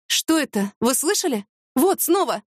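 startled, pitch climbing towards the last words; alarmed. A narrator's reading. Sudden bang, startled questions. (встревоженно, высоким голосом)

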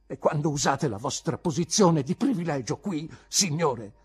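high, loud accusation; wronged teacher confronting a privileged pupil; heated, upset rebuke; agitated indignation. Acted. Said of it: "le cui labbra tremavano molto".

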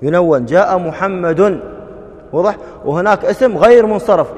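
ordinary talk

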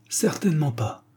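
high-pitched, dignified refusal. A narrator's reading. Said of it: "dit mademoiselle Lyle avec dignité". (avec dignité, voix haut perchée)

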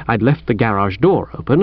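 plain reading voice

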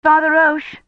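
high, loud call. A narrator's reading. Woman calls to the priest, calling out. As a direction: call across a church. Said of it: "Kivrin called".

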